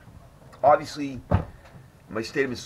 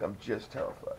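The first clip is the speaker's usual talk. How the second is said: softer repeat; 11 dB quieter than usual. Retold or imitated. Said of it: "I was like".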